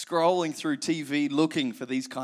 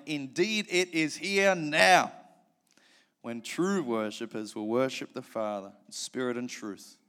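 ordinary talk, nothing marked

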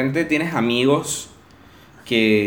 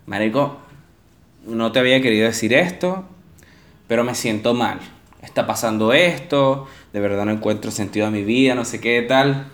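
softer, subdued mock confession; quiet sad quote; 4 semitones lower, 4 dB quieter than usual. A friend confessing by text that he feels bad, subdued. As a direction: say it low like a friend feeling down.

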